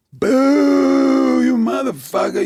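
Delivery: loud, high boo into insults; heckling jeer. Retold or imitated. Heckler at the next night's club, high loud jeering. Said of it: "There she go again".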